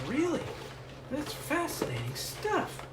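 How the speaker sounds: high-pitched, marvelling reenactment; mock fascination. Host retelling reaction to Canadian trivia, high mock fascination. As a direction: recount your amazement in a high voice.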